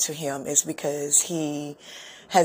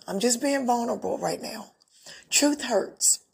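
becoming emotional; 4 semitones higher than usual. Real, not acted. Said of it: "just like I'm becoming emotional right now".

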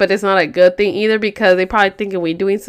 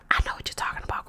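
hushed, knowing retold whisper; conspiratorial whisper; 13 dB quieter, much faster than usual. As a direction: whisper it like a secret to a friend. Secret signalling between two friends.

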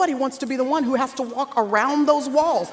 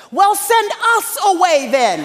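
higher-pitched mock protest as the disciples; disciples' retort; 5 semitones higher, 4 dB louder than usual. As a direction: throw it back higher, imitating put-out disciples. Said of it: "And they say".

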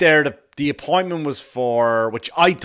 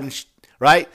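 ordinary talk, nothing marked

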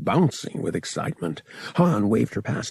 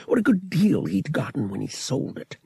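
plain reading voice

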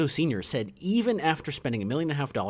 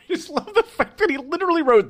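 laughing; 7 dB louder than usual. Real, not acted. Laughing at critic quote.

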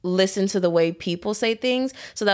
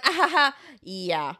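fake polite laugh; 3 semitones higher than usual. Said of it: "I was so pissed".

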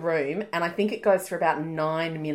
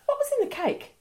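much higher mock mum voice; mum's voice. Imitating mum asking about the cake, high. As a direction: pitch it way up like mum, suspicious. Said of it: "She goes".

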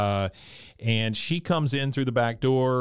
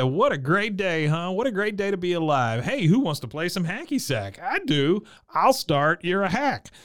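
high-pitched imitation of giddy delight; mock-cheerful, singing out the excitement; gleeful Bill; 7 semitones higher than usual. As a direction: play an overjoyed goofball, pitched up. Imitating gleeful Bill playing hacky sack.

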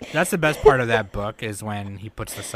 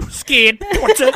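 slow, high mock villain; high laughing villain voice; 10 dB louder, slower than usual. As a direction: repeat it laughing. Echoing the line, laughing.

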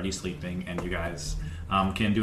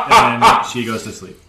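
laughing; 2 semitones higher, 11 dB louder than usual. Retold or imitated. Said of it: "Lyra is like".

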